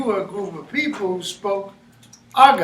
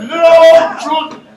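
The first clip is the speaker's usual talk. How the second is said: laughing description; 6 semitones higher, 8 dB louder than usual. Real, not acted. Describing comic drunk character.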